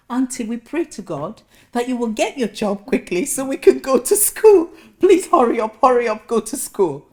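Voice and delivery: put-on high, loud begging child voice; pleading young relatives. Relatives writing to auntie, loud childish pleading. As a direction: urge her on, desperate and childlike. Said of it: "letters from Nigeria saying".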